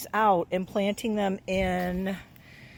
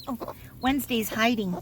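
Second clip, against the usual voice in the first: ordinary delivery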